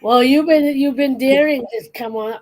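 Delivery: high loud teasing call-out; excited. Real, not acted. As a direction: call him out with a dare. Daring the viewer loudly.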